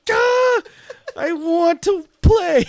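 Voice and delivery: high, loud, slow laughing wail; frustrated wail. Retold or imitated. Stuck player aching to join the game, laughing high wail. Dreamer can't reach the game.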